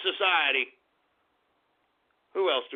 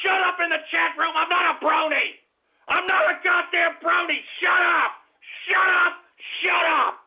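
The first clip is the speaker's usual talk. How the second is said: furious shouting; 7 semitones higher than usual. Acted. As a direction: bark down the chat room. Host enraged by chat shout-outs.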